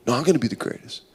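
low, muttered contradiction; hushed, petty one-upmanship; arguing under breath. Acted. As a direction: bicker softly, insisting on first place. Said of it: "the disciples arguing amongst each other".

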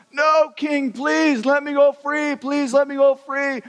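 high, slow, whiny pleading; begging debtor. Acted. Servant pleads with the king to go free, high whiny begging. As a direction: beg the king for mercy.

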